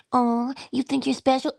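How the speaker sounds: high, taunting question; mocking inner voice. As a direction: needle them like a doubting inner critic. Voicing the negative inner conversation.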